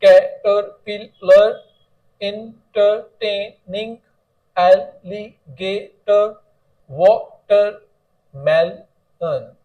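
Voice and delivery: each word said slowly and deliberately for the class; teacher reads out four-syllable words to students, slowly; slow word list demo. Shown as a demonstration. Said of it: "four syllable words में आप पढ़ सकते हैं".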